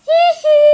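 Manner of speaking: high squealing ghost giggle; a shrill playful scare laugh; spooky giggle. Pretend. Showing scare face, high squeal. (nakakatakot na hagikgik)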